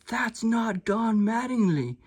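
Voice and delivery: high, drawn-out mock yell; retold angry yell. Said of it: "He yelled at me".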